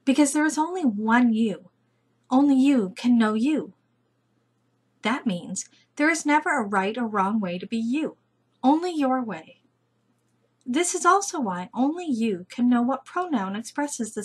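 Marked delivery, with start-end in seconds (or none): none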